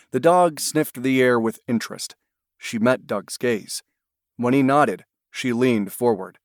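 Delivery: usual speaking voice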